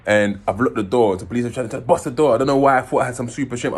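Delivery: ordinary delivery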